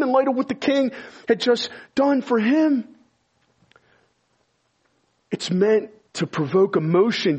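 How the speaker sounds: high, loud, rising preacher's outrage; preaching at full force. Acted. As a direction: build the sermon to full fire. Preaching about the servant's shock.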